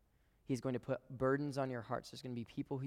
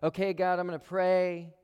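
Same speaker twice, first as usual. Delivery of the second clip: high, loud mock prayer; a brash, loud imitation of a hasty pray-er; mock loud declaration; 6 semitones higher, 11 dB louder than usual. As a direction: blurt it out like a quick promise. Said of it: "He doesn't just say".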